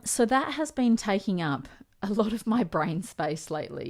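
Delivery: normal talking voice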